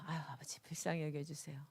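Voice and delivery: whispered plea for mercy under the breath; whispered prayer. Retold or imitated. Pastor retelling her whispered prayer while angry, whispering. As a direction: pray it to yourself to calm down.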